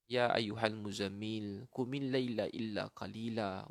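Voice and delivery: slow, drawn-out verse recitation; Quran recitation. Real, not acted. Preacher recites the night prayer verse, slow recitation. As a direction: recite with long, held vowels.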